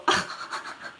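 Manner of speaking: breathless shocked giggle; stifled laugh. Real, not acted. Woman retelling the stranger's offer, stifled shocked laugh. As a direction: laugh under your breath in disbelief.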